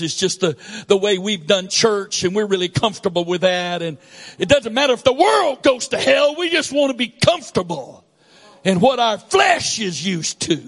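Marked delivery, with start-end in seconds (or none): 4.4-10.6 raised, emphatic preaching voice; fervent preaching. Acted. Preacher scolding comfortable churchgoers, fervent preaching. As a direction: push the rebuke out to the pews.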